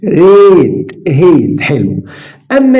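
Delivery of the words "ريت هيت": "ريت" loud with a long vowel, then "هيت"; lengthened vowel. Shown as a demonstration. Teacher contrasting long vowels, "ريت، هيت" drawn out. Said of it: "لو حطيت ايه تبقى هيت".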